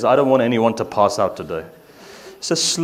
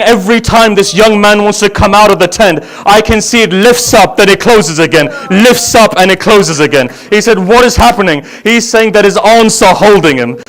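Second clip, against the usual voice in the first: full-voiced grieving chant, louder and higher; loud lament recitation; 10 semitones higher, 12 dB louder than usual. Reciter mourning Ali Akbar's farewell to the gathering, loud wailing lament. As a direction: cry the story out to the mourners.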